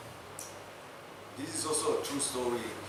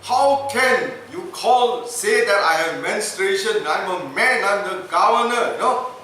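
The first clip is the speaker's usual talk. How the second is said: angry rant; 5 semitones higher, 9 dB louder than usual. Retold or imitated. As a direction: spit each word at him. Governor ranting at doctor.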